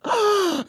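high gasp of panic, imitated; mock scared gasp. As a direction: suck in air like a startled kid. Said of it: "they would get so scared".

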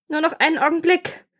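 high-pitched plea for delay; pleading wife. A narrator's reading. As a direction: stall him in a high, pleading voice.

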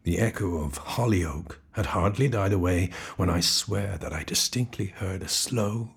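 plain reading voice

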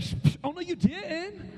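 imitating sassy girls, drawn-out and playful; mock sass with a dismissive psh; hammed-up schoolgirl attitude, slow on the words. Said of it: "I need some attitude".

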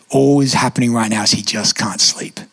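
ordinary talk, nothing marked

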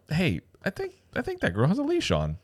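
high, hushed retold aside; soft, high re-enacted murmur to a buddy; discreet aside. Discreetly telling friend without staring.

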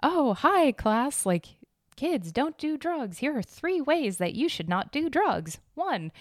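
high, slow, sing-song lecture voice; mock a cheesy scripted teacher; robotic teacher voice. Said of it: "a fucking robot is talking to you".

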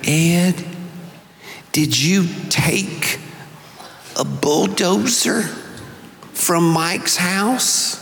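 lightly imitated Mississippi mother, slow and measured; a mother's slow Mississippi drawl, lightly done; Mississippi accent. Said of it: "she said in her Mississippi accent".

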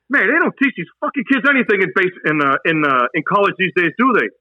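mock the kid to the other sergeant; imitating a sergeant ridiculing a recruit.

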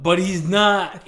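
slow, loud "but he's not"; emphatic. Real, not acted. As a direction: lean hard on the contrast. Emphatic contradiction.

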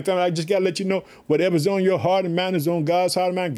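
usual speaking voice

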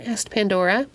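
ordinary delivery, nothing marked

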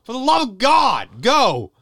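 high-pitched exasperated shout; desperate yell. Acted. Actor begs the rambling director to hurry, desperate yell. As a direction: yell it, at the end of your patience. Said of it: "you shouldn't talk to me that way".

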